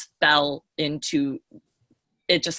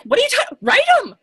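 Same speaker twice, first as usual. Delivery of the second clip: much higher and louder, imitating an incredulous burst; incredulous outburst; 7 dB louder than usual. Imitating her boss insisting she use his name, high incredulous outburst. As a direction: play him blurting it out in disbelief. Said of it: "And he's like".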